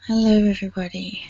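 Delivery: ordinary talk, nothing marked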